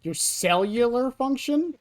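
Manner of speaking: slow, rising in disbelief; incredulous. Real, not acted. Echoing TTS in disbelief.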